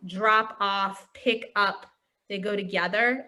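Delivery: ordinary delivery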